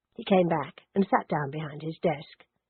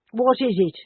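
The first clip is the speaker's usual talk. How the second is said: louder, blunt and unwelcoming at the door; gruff housekeeper; 5 semitones higher, 5 dB louder than usual. Acted. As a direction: bark it like a sour housekeeper. Truculent housekeeper answers the door.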